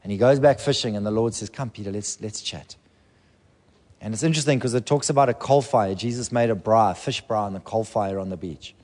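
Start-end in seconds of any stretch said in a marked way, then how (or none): 1.5-2.8 soft, gentle call; tender invitation. Acted. Jesus calling Peter back on the beach, soft and tender. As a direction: coax him back quietly.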